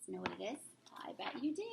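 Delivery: put-on breathy secret whisper to the kids; playful whisper, sharing a secret. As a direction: lean in and whisper it. Stage whisper to the audience.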